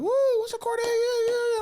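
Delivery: very high, loud whooping; squealed, over-excited hollering; mock cheering. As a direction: imitate a hyped-up crowd greeting. Imitated cheering as comic is introduced.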